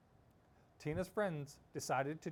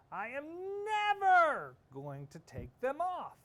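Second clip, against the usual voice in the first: high, loud, slow vow on never; emphatic vow; 11 semitones higher, 6 dB louder, slower than usual. Acted. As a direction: drag out never like a stubborn kid. Tina vows to keep socks.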